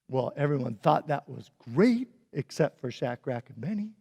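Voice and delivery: ordinary talk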